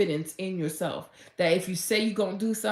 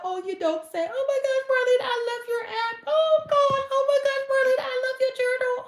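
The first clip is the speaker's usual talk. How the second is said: very high, louder squealing delight; ecstatic squealing fan; 3 dB louder, faster than usual. Pretend. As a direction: squeal in pure joy at being recognised. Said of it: "I'm like".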